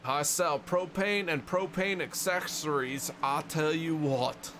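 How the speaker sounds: slow Missouri twang on the catchphrase. Retold or imitated. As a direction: drawl it like a Missouri propane salesman. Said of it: "their Missourian accent works with it".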